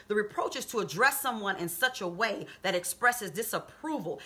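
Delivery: ordinary talk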